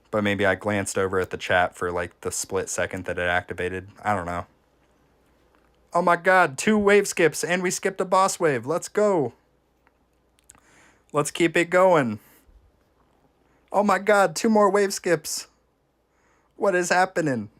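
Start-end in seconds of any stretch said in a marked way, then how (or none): 5.9-17.5 high-pitched, amazed gamer hype; excited celebration. Real, not acted. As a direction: celebrate an unbelievable lucky streak, voice pitched way up. Celebrating repeated lucky wave skips.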